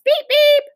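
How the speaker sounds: high, loud horn beeps; child imitating a bus horn; honking. A narrator's reading. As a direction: honk like a trolley bus. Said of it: "honked Ramona".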